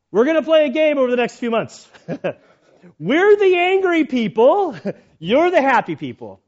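loud, high announcement of a game; mock commands. Dad fake-translates the German guard's orders, loud commands. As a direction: bellow the rules as a pretend angry guard. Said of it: "giving commands".

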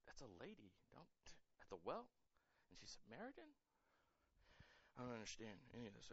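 hushed, baffled muttering, fast at the end; puzzled whisper-quiet aside; confused disciples. Retold or imitated. Disciples baffled at Jesus talking to a woman, hushed confusion. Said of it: "here come the disciples like".

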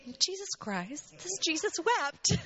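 slow, high and tearful; fighting tears on each word. Real, not acted. Woman telling the congregation Jesus wept, tearful. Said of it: "I'm sorry if I cry".

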